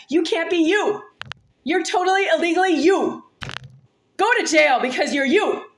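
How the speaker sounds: high-pitched, indignant accusation; angry rant. Real, not acted. Telling an accuser to go to jail, high and angry.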